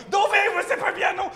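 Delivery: high panicked mock shout; mock yell. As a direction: yell at the locals in desperation. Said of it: "je leur criais".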